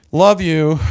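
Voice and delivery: a sneering, dismissive "Love you."; mocking love you. Shown as a demonstration. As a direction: toss off "Love you." with contempt. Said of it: "Mockery has to do with tone".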